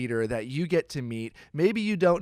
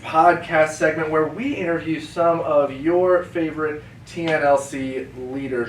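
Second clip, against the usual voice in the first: hyped podcast intro; 3 semitones higher, 10 dB louder than usual. Acted. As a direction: welcome the fans at full volume. Scripted show intro.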